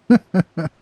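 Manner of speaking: a short, loud burst of laughter. Real, not acted. Streamer laughing at a favourite character, loud laugh. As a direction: laugh out at the screen.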